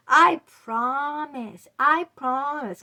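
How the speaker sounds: false promise. Retold or imitated. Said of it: "he made promises".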